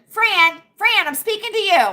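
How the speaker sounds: calling out. Real, not acted. Calling out to Fran.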